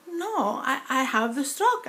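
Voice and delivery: normal talking voice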